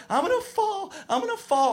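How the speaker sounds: put-on high whiny scared kid voice; nervous camper refusing to skate, high and whiny. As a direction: whimper it like a frightened child.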